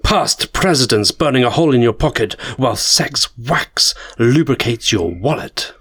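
normal talking voice

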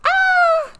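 very high mock scream; imitated scream. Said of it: "she heard Carla scream".

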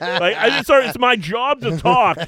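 high, loud, affronted imitation; indignant protest. [name] defending his right to talk, indignant. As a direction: plead your case in mock outrage.

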